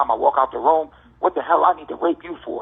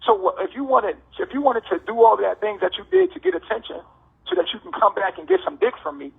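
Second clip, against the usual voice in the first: ordinary delivery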